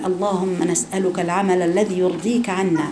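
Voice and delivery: slow, soft prayer voice; prayerful supplication. Shown as a demonstration. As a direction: pray it slowly and gently. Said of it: "علشان كده بقول".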